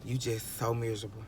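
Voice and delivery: ordinary delivery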